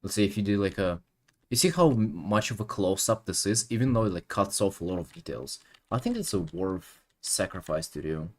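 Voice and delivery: ordinary talk, nothing marked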